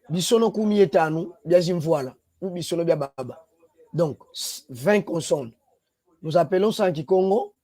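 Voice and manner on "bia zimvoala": usual speaking voice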